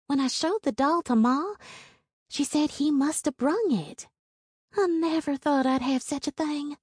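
put-on wide-eyed poor girl showing off a gift; awed country girl's voice; young Hazel voice. A narrator's reading.

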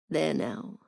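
a hint of a low-voiced British young man; low, soft Owen. A narrator's reading. Owen gently teasing the narrator about the sun. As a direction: say it softly, teasing.

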